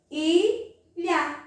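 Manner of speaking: "Ilha" in two clear beats, a short gap between; "Ilha" sounded out for a class; syllables separated. Shown as a demonstration. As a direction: split "Ilha" after the first vowel. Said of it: "Vamos ver".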